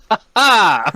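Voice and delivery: high, loud "ha ha"; acted laugh. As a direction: crow a triumphant mock laugh. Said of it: "And I go".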